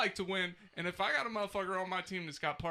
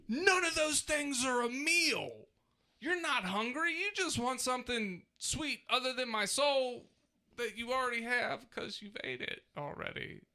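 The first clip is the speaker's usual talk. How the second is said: high-pitched exasperated wail; 8 semitones higher than usual. Real, not acted. Father venting to friends about snacking kids, exasperated high pitch. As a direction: voice pushed way up in mock despair.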